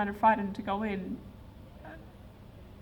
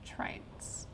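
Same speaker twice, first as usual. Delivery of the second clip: whispered; 14 dB quieter than usual. Real, not acted. Speaker confessing his love of trains, hushed whisper. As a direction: breathe the word out in a hushed whisper. Loving declaration about trains.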